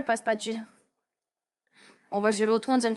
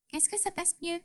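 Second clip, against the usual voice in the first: voice test; 5 semitones higher, 9 dB quieter than usual. Shown as a demonstration.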